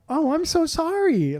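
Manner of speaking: high, slow, drawn-out apology; sweet Canadian lady. Retold or imitated. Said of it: "sweet, nice Canadian lady being like".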